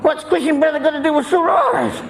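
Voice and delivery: high outraged confusion with a laugh; bewildered patient. Retold or imitated. Patient questioning the doctor, high and bewildered. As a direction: squawk the question, lost.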